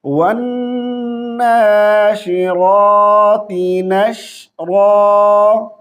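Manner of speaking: slow melodic verse; slowly recited verse for the class; tajweed recitation. Shown as a demonstration. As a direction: recite it drawn-out. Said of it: "सुने यहाँ से".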